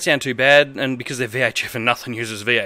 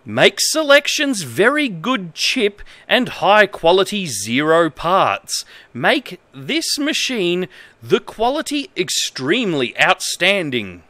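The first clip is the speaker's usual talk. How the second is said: reads broken manual text in a lightly formal voice; straight-faced reading of nonsense instructions; mock-earnest manual reading; 5 semitones higher, slower than usual. Reads bad manual translation aloud.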